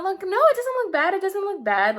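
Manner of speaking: fake reassurance. Mocking classmates who claim her ruined hair looks fine, high fake-sweet voice. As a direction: parrot their fake comfort in a high sweet voice. People lying to her face.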